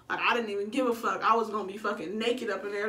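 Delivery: ordinary talk, nothing marked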